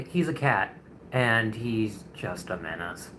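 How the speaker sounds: ordinary delivery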